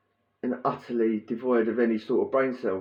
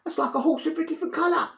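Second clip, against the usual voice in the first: high, prim voice of the little window man; Wizard of Oz gatekeeper. Retold or imitated. Telling mates about the guy at the hatch, squeaky high Oz guard voice. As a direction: pipe up like the guard at the Emerald City gate. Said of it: "like the geezer in The Wizard of Oz".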